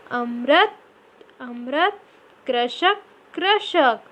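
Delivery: each word said clearly and repeated; careful model pronunciation. Shown as a demonstration. Teacher models each word twice for pupils to repeat. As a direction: sound out वृक्ष, ग्रह, अमृत, कृषक carefully for pupils to copy. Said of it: "जैसे मैं बोल रही हूँ उसी तरह से आपको भी बोलना है".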